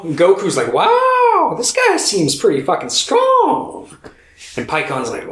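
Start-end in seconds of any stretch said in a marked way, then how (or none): 0.7-3.9 very high, slow, put-on wonder; mock awe. Goku sizing up a giant monster, mock awe. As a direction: draw it out in squeaky amazement.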